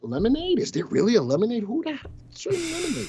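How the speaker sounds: very high, squeaky disbelief; mock outrage at a lemonade stock; incredulous. Real, not acted. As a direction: act shocked at the ticker.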